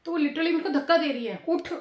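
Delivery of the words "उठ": high, louder insistent aunt shooing her away; hammed-up bossy aunt, much higher, demanding she get up; pushy aunt. Aunt pushing the daughter-in-law off the stool: high, loud "उठ, उठ". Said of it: "ऐसे करके वो मेरे को बोल रही हैं".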